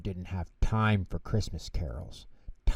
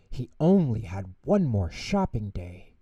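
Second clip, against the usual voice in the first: ordinary delivery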